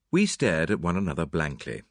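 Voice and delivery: usual speaking voice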